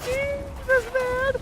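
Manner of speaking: very high squeaky mock "Jesus, man"; mock exasperation. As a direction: squeal it in exaggerated disbelief. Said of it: "And I was like".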